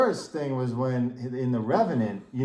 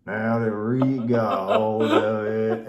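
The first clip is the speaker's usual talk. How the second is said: slow, hammed-up mountain man accent; Leonardo DiCaprio mountain man accent; 3 dB louder, much slower than usual. Mocking DiCaprio's Revenant line for cohost, slow bad mountain man accent. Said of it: "the worst, like, mountain man accent I've ever heard".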